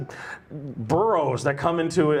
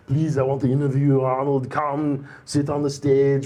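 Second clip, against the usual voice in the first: hammed-up Austrian strongman; bad muscleman impersonation, inviting a guest on stage; Arnold Schwarzenegger. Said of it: "give the Arnold impersonation to him".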